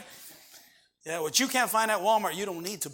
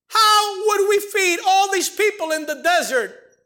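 higher, louder, slow preaching cry; full-force sermon climax; 9 semitones higher, 4 dB louder, slower than usual. Acted. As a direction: drive the point home, slowly.

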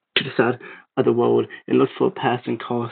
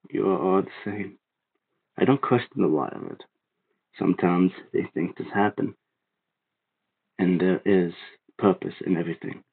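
a calm reaper-like man, grave and gentle; solemn stranger; 5 semitones lower than usual. A narrator's reading. Stranger explaining to the boy why he must stay back, solemn.

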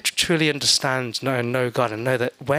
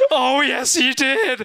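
high, laughing gasp of delighted scandal; mock gossip gasp; 8 semitones higher, much slower than usual. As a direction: squeal it like juicy gossip. Gossiping about arguing couple.

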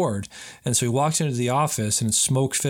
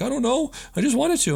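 much higher, mock-innocent shrug; mocking whiny voice; 13 semitones higher than usual. Host mocking the bully's answer in a high whine. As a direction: parody a clueless answer in a high voice. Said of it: "And he's like".

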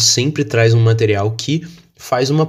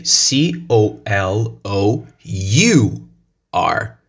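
slow letter-by-letter spelling; slow classroom spelling of each letter; much slower than usual. Shown as a demonstration. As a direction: spell C O L O R slowly, one letter at a time. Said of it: "But in British English".

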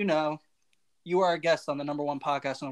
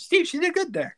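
high cheering voice; 13 semitones higher than usual. Acted. Cheering mom's try.

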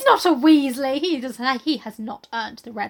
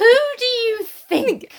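mock indignant; 12 semitones higher, 6 dB louder, slower than usual.

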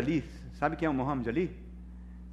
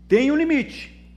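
higher and louder, slowed for weight; emphatic preaching; 8 semitones higher, 7 dB louder, slower than usual. Real, not acted. Pastor warning the congregation, slow and loud. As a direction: lean hard on the limit.

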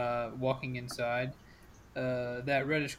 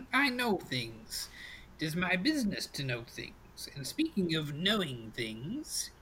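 put-on mysterious old crone. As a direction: intone it like a witch luring a customer. GM plays the crone fortune teller.

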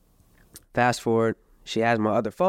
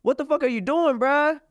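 mock yell; 11 semitones higher, slower than usual. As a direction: replay his own shout at her. His retold yell on video.

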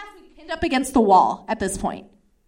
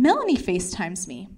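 normal talking voice

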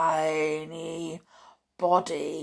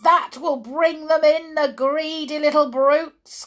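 higher, louder wicked glee; gleeful cry; 8 semitones higher, 8 dB louder than usual. A narrator's reading. Witch gloating over the plan, high gleeful cry. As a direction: cry it with nasty delight. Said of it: "cried the".

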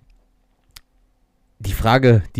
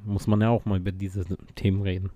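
usual speaking voice

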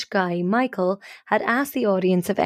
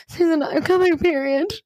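high, urgent retold confession; near-tears desperation; 8 semitones higher than usual. Confessing to her friend why, desperate high-pitched urgency. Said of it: "kinda starting to cry".

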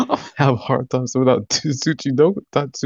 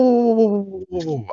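startled whoa; 10 semitones higher than usual. Real, not acted. Player startled by challenge.

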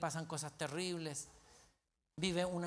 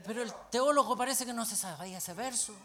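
normal talking voice

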